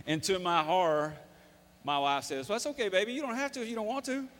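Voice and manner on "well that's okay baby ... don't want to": higher-voiced, coddling retold reassurance; soothing mother. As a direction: imitate a mother reassuring her child, pitched higher. Wife soothes reluctant child in story.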